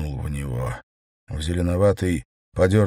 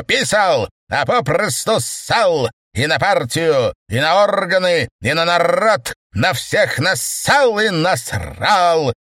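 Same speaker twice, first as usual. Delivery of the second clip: angry tirade; 9 semitones higher, 4 dB louder than usual. Acted. Mokin berates the chairman.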